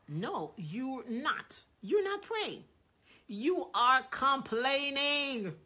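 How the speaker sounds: loud, slow correction; emphatic rebuke. Retold or imitated. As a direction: correct him firmly, word by word. God rebukes Capps.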